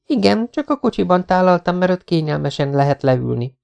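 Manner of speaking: put-on teasingly formal as a young woman; Jessica's voice. A narrator's reading. Jessica's lines. (játékos, magasabb női hang)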